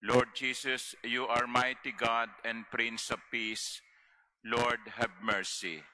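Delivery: ordinary talk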